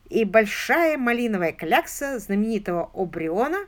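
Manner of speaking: plain reading voice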